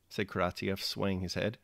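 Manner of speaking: ordinary delivery, nothing marked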